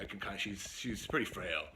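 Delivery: plain reading voice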